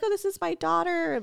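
high, sing-song proud-mom intro; mock a mother showing off her grown daughter; cutesy mom voice. Mimicking mom introducing her grown daughter, high and cutesy. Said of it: "trying to be all cute".

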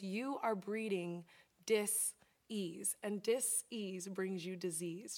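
slow, deliberate, stressing dis-ease as dis-ease; slow deliberate quote. Retold or imitated. Therapist telling her client slowly, dis-ease stressed. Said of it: "And she goes".

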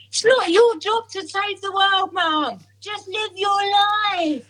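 a lightly put-on teenage daughter, drawn out; hint of a lecturing daughter; imitating daughter. Daughter voiced in retold argument.

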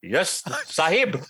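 slow mock Indian accent; hammed-up Indian telemarketer accent. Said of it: "worst Indian telemarketer ever".

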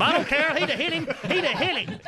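high, loud comic insistence; riled-up old fan voice; insistent uncle. Acted. Uncle insists, ignoring correction.

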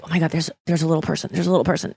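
murmured low and hurried, as gossip; imitated furtive whisper. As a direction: mimic someone whispering about a passerby. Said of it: "hears you whisper".

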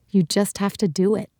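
ordinary talk, nothing marked

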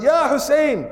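high imitated call. Farmer crying out to Hussein, imitated high call. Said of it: "he yelled".

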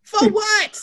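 high, slow, laughing disbelief; incredulous laughing shout. Retold or imitated. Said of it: "I'm like".

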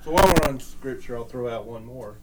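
bad connection. Real, not acted. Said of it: "You're cutting out a little bit there".

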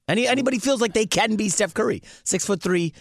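high-pitched fan enthusiasm, easing down towards the end; getting excited. Real, not acted. Radio host raving about Steph Curry, getting excited. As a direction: gush excitedly about your favorite player. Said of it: "I'm getting excited about Curry again".

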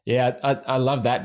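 ordinary talk

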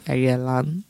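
hushed low flirting question; soft, low come-on. Acted. Mama Johnny asks the caller if he's alone, hushed. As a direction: lean in close and murmur it.

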